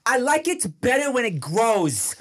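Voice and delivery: put-on high, slow toddler voice; imitating bratty little girl. Toddler storms off after roasting her brother, imitated high bratty voice. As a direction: deliver the child's parting shot, drawn out. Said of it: "And then she goes".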